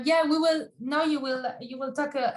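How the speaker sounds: normal talking voice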